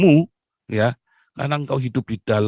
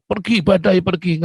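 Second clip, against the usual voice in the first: mock rebuke; 8 semitones higher, 5 dB louder than usual.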